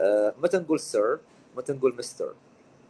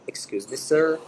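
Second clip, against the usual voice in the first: a slightly raised, polite "Excuse me, sir."; polite call to a waiter; 4 semitones higher, 4 dB louder than usual. Shown as a demonstration. Teacher showing how a diner calls a waiter: polite call. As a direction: catch the waiter's attention politely. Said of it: "مثلًا في مطعم".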